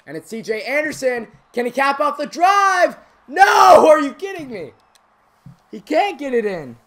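high, shouted live commentary; excited play-by-play. Acted. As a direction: call the drive at full volume, aghast when it fails. Live play-by-play at full force.

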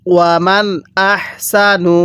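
"ওয়ামান" with its n sounded distinctly before "আহসানু"; clear noon sakin. Shown as a demonstration. Teacher shows students the clear noon, "ওয়ামান" n kept distinct. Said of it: "কিভাবে পড়ব?".

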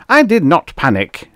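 loud, high indignant bellow; outraged roar of protest. A narrator's reading. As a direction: bellow a furious denial. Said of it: "Nitworth bellowed".

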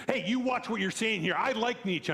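lightly acted offended reply; mock offended warning. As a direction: play the touchy chat opponent. Retold angry chat reply.

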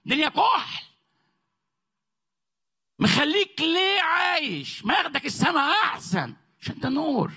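high, impassioned sermon voice, rising to a peak; fiery preaching. Acted. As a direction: rouse the congregation with every line. Preaching at full force.

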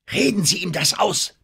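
hoher, dringlicher Befehl; befehlend. A narrator's reading. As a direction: befiehl es dem Kapitän eindringlich. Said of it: "befahl Denham". (drängende Anweisung mit hoher Stimme)

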